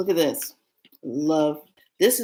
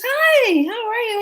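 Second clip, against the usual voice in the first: much higher, louder shop greeting; imitating the owner; 7 dB louder than usual. The owner greeting her, a very high cheery voice. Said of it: "She goes".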